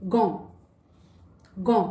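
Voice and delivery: गो said with a hard g and a nasal vowel; French "gant", गो. Shown as a demonstration. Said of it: "कुछ examples देखते हैं।".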